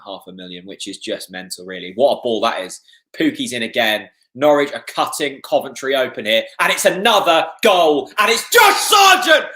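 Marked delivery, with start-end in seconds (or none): 1.9-6.4 rising commentary. Real, not acted. Live commentary on a Norwich attack. 6.6-9.5 full-throated, much higher and louder goal scream; goal celebration. Real, not acted. Fan screaming as his team scores the third, a real roar.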